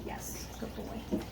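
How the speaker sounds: soft whispered praise, barely voiced. Real, not acted. Trainer quietly praising her dog, whispered. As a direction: breathe the praise gently to the dog.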